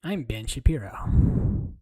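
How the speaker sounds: slow whispered mock introduction; whispered Ben Shapiro. Streamer whispers as Ben Shapiro, slow and creepy. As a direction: whisper the name like a creepy joke.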